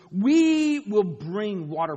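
slow, loud declaration; angry Moses. Acted. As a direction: hammer each word out.

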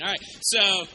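ordinary talk

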